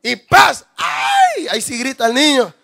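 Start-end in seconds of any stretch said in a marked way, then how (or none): 0.8-1.5 ay muy agudo gritado; grito de bebé imitado. Pretend. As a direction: imita el primer grito del recién nacido. Recién nacido grita al golpe. (grito agudo de bebé)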